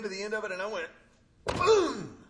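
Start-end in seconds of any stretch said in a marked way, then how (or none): 1.4-2.2 loud, high mock crash; big playful sound effect boom. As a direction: belt out the jump like a cartoon impact. Jumping hard on diving board.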